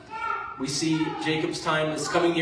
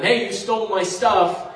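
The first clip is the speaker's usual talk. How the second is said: higher, louder accusing call; mock accusing shout; 5 semitones higher, 7 dB louder than usual. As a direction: call out the thief like an angry Egyptian. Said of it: "he sends the Egyptians after them".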